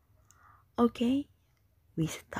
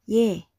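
"ye" short and loud, dropping; falling tone; 7 dB louder than usual. Shown as a demonstration. Teacher's third tone example, "ye" falling. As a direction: punch "ye" downward. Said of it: "The third tone is a falling tone".

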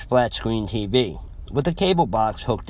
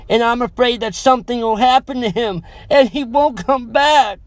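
much higher, louder, rising to a frantic cry; sarcastic yell; 11 semitones higher, 5 dB louder than usual. A narrator's reading. Scared girlfriend yelling at her boyfriend going back, sarcastic yell. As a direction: yell it out, building to fear at the end. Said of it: "yelled sarcastically".

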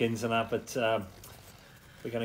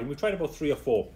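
normal talking voice

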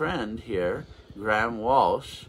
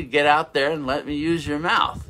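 loud, raised retold order from God; loud retold command; 6 semitones higher, 9 dB louder than usual. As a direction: call it out like a summons. God sending anyone out to preach.

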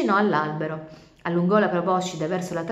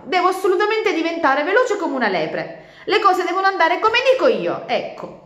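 much higher, louder stubborn turtle; sulky insistence; 12 semitones higher, 7 dB louder than usual. Acted. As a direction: stamp your foot on every word. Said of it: "tornò in classe imbronciata". (tartaruga imbronciata e testarda)

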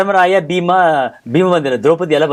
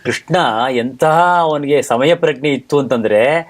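normal talking voice

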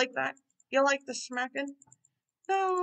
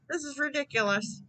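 ordinary delivery

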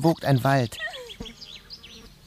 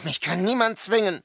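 defiant cry; 8 semitones higher than usual. Acted. Defiant refusal.

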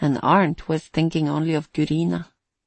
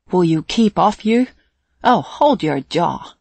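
angry yell; 6 dB louder than usual. Acted. Fishermen quarrelling in boats.

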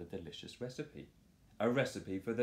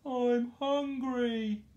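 much higher, louder, slow howl; 7 dB louder, much slower than usual. Acted. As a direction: howl for food. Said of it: "howled Horace".

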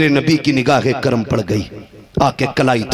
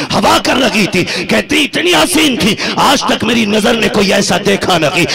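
loud, high impassioned sermon chant; full-force preaching; 12 semitones higher, 6 dB louder than usual. Acted. (पूरे जोश में ऊँचा उपदेशी स्वर)

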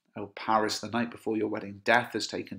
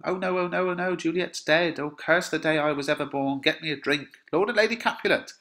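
high-pitched mock panic; panicked lament; 6 semitones higher, 4 dB louder than usual. Nurse crying that Juliet's dead, acted panic. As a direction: paraphrase the wailing in a raised, distressed voice.

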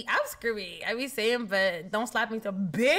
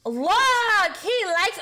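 excited call; 6 semitones higher, 6 dB louder, much slower than usual. Real, not acted. Pointing out the meter.

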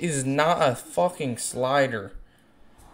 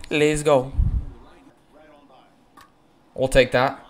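usual speaking voice

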